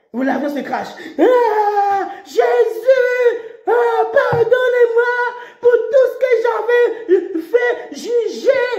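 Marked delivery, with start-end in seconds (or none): 1.2-8.7 high shouted plea to Jesus; terrified crash victim. Pretend. Preacher acting a falling passenger begging Jesus, high and shouted. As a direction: wail for mercy as the plane falls.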